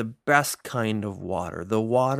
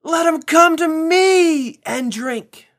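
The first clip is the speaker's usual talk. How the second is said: loud proclaiming yell; 11 dB louder than usual. Acted. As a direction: cry it out to the whole temple crowd. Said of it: "he yelled in a loud voice".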